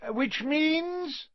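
high, called-out headmaster voice; Dumbledore calling out. A narrator's reading. Dumbledore calls over the applause, high and ringing. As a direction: call it over the cheering crowd. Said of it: "Dumbledore called".

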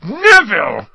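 exploding in exasperated anger; Ron. A narrator's reading. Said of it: "Ron exploded".

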